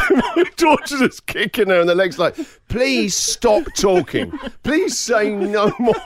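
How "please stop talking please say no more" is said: high, drawn-out begging; mock pleading. Mortified daughter kicking her mum, drawn-out mock pleading. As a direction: plead slowly, stretching every word in mortification.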